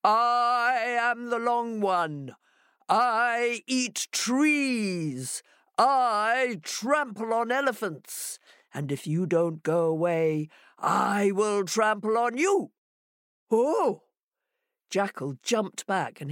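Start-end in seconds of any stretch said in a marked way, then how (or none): 0.0-12.7 slow, stretched-out monster threat; booming monster voice. Acted. Monster inside the house threatens Hare, booming slowly. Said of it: "the voice replied". 13.5-14.0 short, high frightened oh; startled cry. Acted. Jackal hears the monster's threat, startled.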